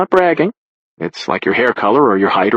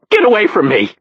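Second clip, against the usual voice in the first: higher, angry yell of rejection; hurt and furious, pitched up high; angry shout; 9 semitones higher than usual. Acted. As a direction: push the helper away with your voice. Beaten man rejects help.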